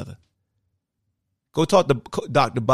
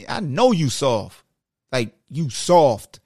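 slow, deliberate, every word leaned on; drawn-out pointed callout to the fans; slow emphatic stress; 3 dB louder, much slower than usual. Real, not acted. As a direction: spell it out slowly so it lands. Calling fans soft, emphatic.

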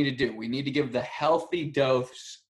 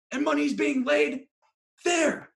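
excited, high-pitched; 14 semitones higher, 5 dB louder, slower than usual. Shown as a demonstration. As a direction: voice jumps way up and loud. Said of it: "repeated higher and louder".

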